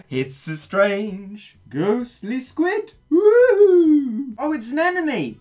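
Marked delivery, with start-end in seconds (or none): 0.1-2.9 slow, drawn-out ghostly voice, pitched up; spooky narrator. Pretend. Gamer spooking viewers about a ghostly squid, slow and eerie. 3.1-4.3 loud, high ghost wail; a hammed-up spooky woo; ghostly woo. Gamer hamming up a ghost noise, loud high wail.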